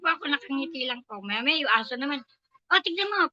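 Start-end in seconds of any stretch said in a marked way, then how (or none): 2.7-3.3 higher, louder retold scolding; imitating a shouting employer; shouting boss. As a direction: bark it like an irritated boss. Employer shouting about the dog.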